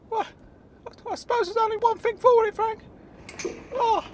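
very high character voice, reluctant; Claude. Acted. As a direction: grumble along in a squeaky high voice. Claude gives in, grumbling.

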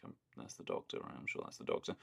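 whispered inner thought, unsure. Retold or imitated. Remembering her own doubt on rewatch, whispering. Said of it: "but in my head I was like".

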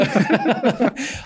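high laugh at his own cheek; laughing at the cheeky idea; amused laugh. Real, not acted. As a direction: burst out laughing.

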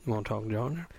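quiet, deep-pitched try at Georgia Southern; Georgia accent. Shown as a demonstration. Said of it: "I could do different accents".

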